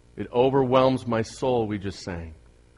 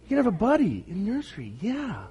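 put-on baby talk; 7 semitones higher, faster than usual. Cooing to newborn baby.

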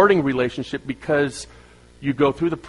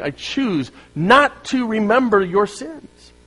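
voicing God; 7 semitones higher, slower than usual. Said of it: "that's what God was saying".

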